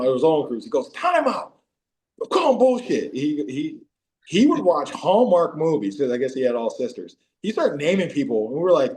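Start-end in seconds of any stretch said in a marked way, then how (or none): 0.9-3.1 high-pitched holler stopping the game; mock objection. Player disputing an answer in the movie game, high mock protest. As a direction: call a halt, voice way up.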